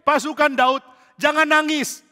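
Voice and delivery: high, loud call to the troops. Acted. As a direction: shout across to stop them crying. Man calls out to soldiers.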